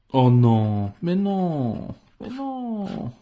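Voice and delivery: dismay. Real, not acted. Déception au tirage raté.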